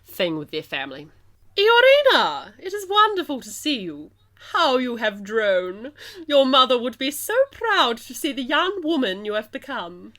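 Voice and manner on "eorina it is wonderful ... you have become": high, grand, plummy English queen; plummy English accent of a gracious queen. Acted. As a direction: play the Queen in your poshest English accent. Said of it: "my plummiest English accent".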